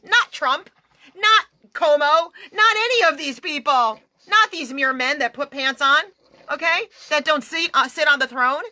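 fiery preaching. Real, not acted. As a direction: blast it from the pulpit. Preacher rails against leaders.

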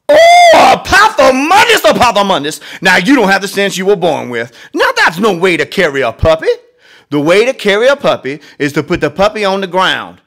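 very high, loud exasperated mother wailing; fed-up mother scolding her son, high and loud; frustrated mama. Acted. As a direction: throw up your hands like a worn-out mother. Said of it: "She looks frustrated".

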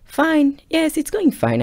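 high, chirpy mock answer pushed way up; mock cheerful reply. Telling his girlfriend it's going fine, mock high chirp. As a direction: put on a falsely breezy fine.

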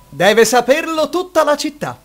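excited boast. Acted.

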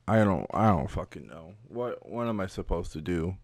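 ordinary delivery

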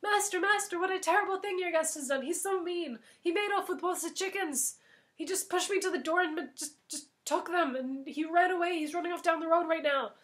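hammed-up frantic tattling; false alarm shout. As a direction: shout a fake outrage to the master.

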